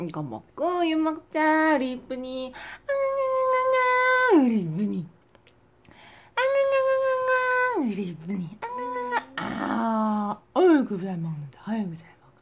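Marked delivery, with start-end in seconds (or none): 2.9-4.4 high falling wail imitating the baby; mock baby wail. Mother imitating her baby's cry, high and falling. 6.3-7.9 high falling wail imitating the baby; mock baby wail. Mother imitating her baby's cry, high and falling. 8.6-9.2 high falling wail imitating the baby; mock baby wail. Mother imitating her baby's cry, high and falling.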